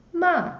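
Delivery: "ma" falling sharply from high; fourth tone ma. Shown as a demonstration. Teacher showing the fourth tone, "ma" high and falling. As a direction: drop "ma" hard from a high start. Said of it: "The fourth".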